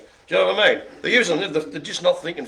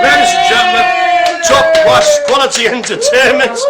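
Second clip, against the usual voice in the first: booming announcer, much louder and higher; showman shout; 12 semitones higher, 10 dB louder than usual. Acted. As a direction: bellow it like a ringmaster. Mock showman announcing the stream.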